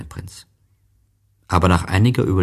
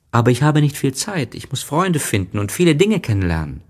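put-on high, fast child voice; voiced little prince, hurried and louder; busy little prince; 9 semitones higher, 6 dB louder, faster than usual. A narrator's reading. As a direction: rattle it off as a busy small boy.

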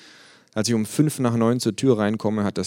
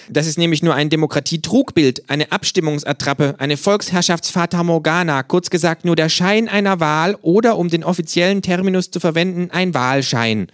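higher, louder kangaroo lecturing, ending "ein Wahlschein" with a firm fall; a smug kangaroo explaining, pitched up; 10 semitones higher, 6 dB louder than usual. Acted. Kangaroo character voice.

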